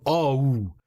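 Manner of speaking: o sliding into an oo sound; English o with oo ending; diphthong o. Shown as a demonstration. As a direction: say o as two sounds, oh-oo. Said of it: "They add the oo sound at the end".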